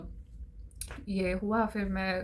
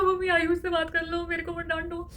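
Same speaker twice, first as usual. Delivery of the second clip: higher, tearful pleading; whiny plea; 8 semitones higher than usual. Retold or imitated. Daughter begging mom to stop scolding, tearful. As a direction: beg mom to just talk to him.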